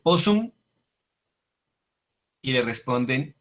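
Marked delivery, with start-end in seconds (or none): 0.0-0.5 Caesar asking. Retold or imitated. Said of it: "él dice".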